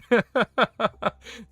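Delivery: high, loud burst of laughter; cracking up hard; laughing. Real, not acted. Laughing at sacred throne joke.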